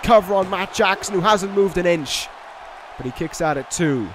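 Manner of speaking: lively ringside play-by-play; wrestling commentator. Acted. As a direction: call the action as it happens. Live play-by-play of the match.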